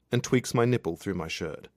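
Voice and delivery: ordinary delivery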